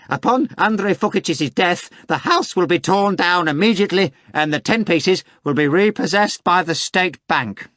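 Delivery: very high mocking cackle of a question; high sneering rotten voice. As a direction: jeer at him from the study. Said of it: "the same voice".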